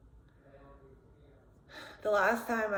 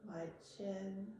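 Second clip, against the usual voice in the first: quietly name each body part, unhurried; guided body scan; 3 semitones lower, 12 dB quieter than usual. Shown as a demonstration. Talks through a body scan.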